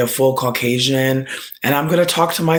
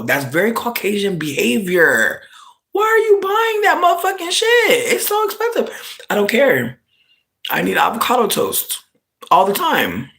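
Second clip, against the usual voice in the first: much higher put-on voice, squealing about avocado; high mock voice; 8 semitones higher than usual. Streamer acts out an argument over pricey avocado in a high put-on voice. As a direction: play a shrill scandalised friend then the defiant buyer.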